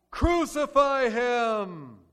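slow, loud, drawn-out mob cry; mob shout. Acted. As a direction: roar it like the crowd. Crowd's demand acted out.

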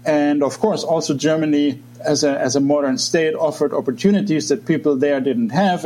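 usual speaking voice

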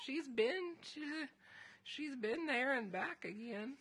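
put-on high old lady, laughing; granny voice through a laugh. Shown as a demonstration. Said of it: "in this voice".

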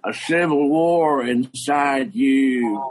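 slow, heavy coach voice pressing the point; coach repeating. Retold or imitated. Coach repeating his verdict to the boy, slow and emphatic. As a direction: lean on it slowly, half shouted. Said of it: "He goes".

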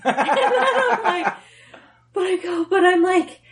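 ordinary talk, nothing marked

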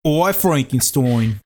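slow, broad Aussie vowels; mock Australian accent. Cohost saying the film title, slow Australian accent.

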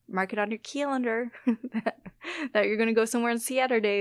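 exaggerated Upper Midwest A sounds; broad Midwestern vowels on "calendar" and "Saturday"; old Midwestern accent. Shown as a demonstration. Showing how terrible the A's are, Midwestern accent. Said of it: "It's like the A's. We're, we're terrible".

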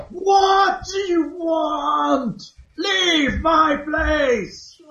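high, slow, wailing ghost shout; shrieking ghost. Acted. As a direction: wail it out as a banshee. GM voices ghost Agatha.

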